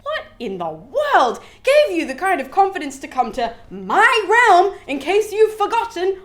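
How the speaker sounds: high, loud, indignant telling-off; mock furious yelling. Neptune yells at the guilty winds, acted furious scolding. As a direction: act out an angry god laying down the law. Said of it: "just yells at them. Like, he's so mad".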